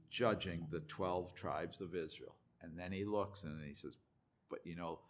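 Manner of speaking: low, soft, measured assurance; gentle solemn Jesus. Acted. Jesus to disciples who will betray him, low gentle solemnity. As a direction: speak quietly and intimately to close followers.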